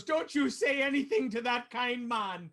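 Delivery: put-on high scolding old barmaid; a fussy innkeeper scolding. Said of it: "she turns to you and goes".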